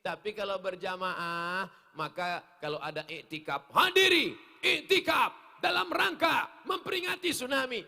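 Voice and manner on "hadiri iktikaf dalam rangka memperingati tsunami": high, very loud preacher's proclamation; forceful public call; impassioned shout. Real, not acted. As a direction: proclaim it to a big congregation. Preacher announces the commemoration loudly.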